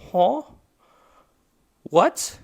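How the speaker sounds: shocked squeak of disbelief; retold disbelief. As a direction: act out the double take. Retold disbelief at dad's claim.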